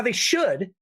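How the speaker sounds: ordinary talk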